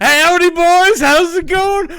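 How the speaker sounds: mock the Southern hosts in a big cartoon twang. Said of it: "we're like".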